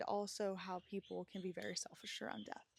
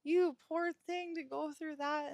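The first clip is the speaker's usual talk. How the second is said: higher, louder, tender comforting; tender pity; 8 semitones higher, 5 dB louder than usual. Retold or imitated. Comforting her inner child about that hard year, tender and higher.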